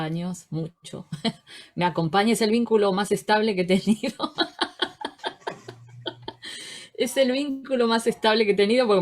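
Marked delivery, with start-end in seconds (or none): 2.3-4.5 says it through a laugh, louder. Real, not acted. Introducing herself to the colleagues, laughing. Laughing joke about profession. 6.9-8.9 joking through laughter; laughing. Real, not acted. Repeating her joke about the profession, laughing.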